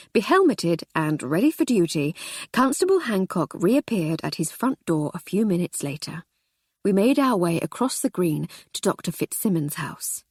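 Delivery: ordinary delivery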